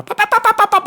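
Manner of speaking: high, quick "pop pop" sounds; mock popping noise. Telling friends about the wild corner, high popping imitation. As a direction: pop it out like the truck clattering.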